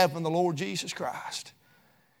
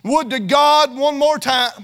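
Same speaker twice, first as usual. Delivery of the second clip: loud, driving preacher's cadence; fiery preaching; 5 semitones higher, 6 dB louder than usual. Acted. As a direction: preach it hard to the congregation. Preaching at full force.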